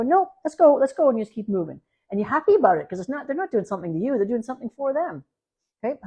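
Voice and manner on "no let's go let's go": bright, higher "let's go" to the dog; a lively, higher call to move on; cheerful upbeat call. Shown as a demonstration. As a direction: lead the dog away happily. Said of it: "you go".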